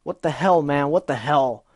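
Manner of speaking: loud, exasperated yelling; angry shout. Acted. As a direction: rage at her, pacing. Gilda pacing angrily.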